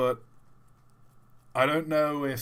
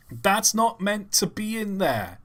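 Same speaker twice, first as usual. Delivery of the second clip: higher, slower mock protest; hammed-up indignant whine; mock indignation; 9 semitones higher than usual. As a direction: huff it like a fussy critic. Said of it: "repeated line".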